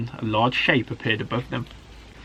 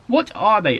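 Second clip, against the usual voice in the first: high, amazed little cub; wondering question; 8 semitones higher, 4 dB louder than usual. A narrator's reading. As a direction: ask in wide-eyed wonder. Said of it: "asked Alfie".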